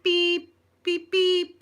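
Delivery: high loud repeated beeps for swear words; bleep, bleep, bleep like a censor; mock censor bleeps. Mum bleeping out her dad's swearing, high and loud.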